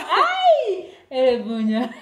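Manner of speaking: laughing out the new word; squeal, then laugh through it. Real, not acted. Learner says the new word delighted.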